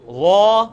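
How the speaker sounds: "وا" sounded from the throat, held short; Arabic letter sound. Shown as a demonstration. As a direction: say "وا" cleanly and deliberately. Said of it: "اب یہ جو سورہ".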